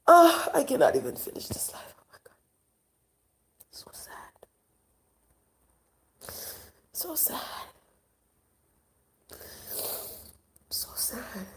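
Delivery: real, choked-up whisper, barely there; fighting back tears, trailing into whispers; near tears. Real, not acted. Vlogger to viewers about neighborhood kids, tearful whisper. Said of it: "it's becoming sad to me".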